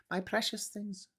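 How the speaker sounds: high, hissy creature voice on precious; mock Gollum; high creepy little creature voice.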